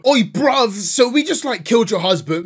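high, loud mock London roadman accent. Killer taunting Isabel, in hammed-up London roadman accent. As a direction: overdo a London street-thug accent, cheeky. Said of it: "I'm not doing the accent well enough".

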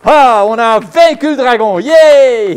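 loud victory whoops; triumphant cheer. Acted. Knight cheering her victory over the dragon, loud and triumphant. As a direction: celebrate beating the dragon.

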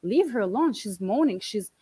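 defending the dying aunt against mom, heated; angry plea. Retold or imitated.

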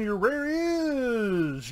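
long, high-swooping IS; suspenseful reveal. Real, not acted. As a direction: stretch IS before revealing the card. Suspenseful card reveal to patron.

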